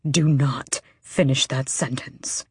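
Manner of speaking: slow, urgent hushed warning; tense whisper. Acted. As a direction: whisper it like a threat. Kael, alarmed, silences her.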